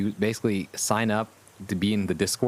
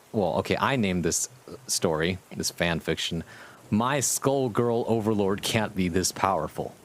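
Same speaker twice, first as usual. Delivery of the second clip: ordinary talk, nothing marked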